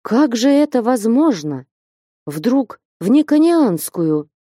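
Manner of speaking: high, dismayed pleading; incredulous entreaty. A narrator's reading. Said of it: "молящим голосом заговорил".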